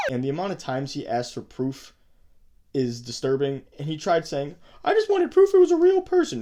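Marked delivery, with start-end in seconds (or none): 4.8-6.4 high, louder mocking whine; mock his excuse in a squeaky voice; mocking quote.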